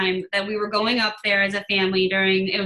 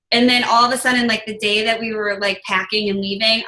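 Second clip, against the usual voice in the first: ordinary talk, nothing marked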